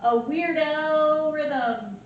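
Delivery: normal talking voice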